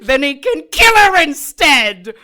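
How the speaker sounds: loud, high laughing delight; gleeful laughing. Acted. Kay delighting in murder talk, loud laughing glee. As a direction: relish the idea, laughing.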